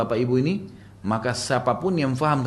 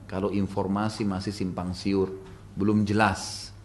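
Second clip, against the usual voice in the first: ordinary talk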